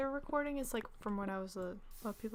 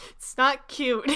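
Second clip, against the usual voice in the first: loud, slow, breaking into laughter; laughing; 13 semitones higher, 6 dB louder, slower than usual. Real, not acted. Gamer judging her recorded voice, loud and laughing. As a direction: say it slowly and loudly through a laugh.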